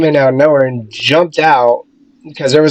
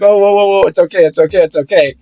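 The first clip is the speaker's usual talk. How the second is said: calm her down gently, a little raised; reassuring; 5 semitones higher, faster than usual. Retold or imitated. Guy calming a terrified judge, reassuring. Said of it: "when he's like".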